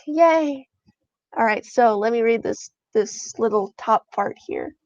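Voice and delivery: ordinary delivery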